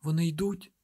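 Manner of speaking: put-on small frightened boy voice; lightly voiced scared boy. A narrator's reading.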